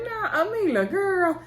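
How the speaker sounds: mock talking to Amila. Talking back to stressed mom on TV.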